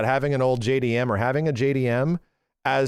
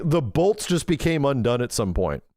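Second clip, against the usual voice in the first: ordinary talk, nothing marked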